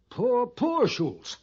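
high, slow, drawn-out pity; theatrical lament. Acted. Pliny pitying Schultz who lost his band, theatrical lament.

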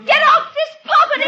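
high, loud frightened order; panicked, forceful bellow; commanding shout. Acted. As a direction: yell down at the intruder from a window. Woman orders intruder off from window.

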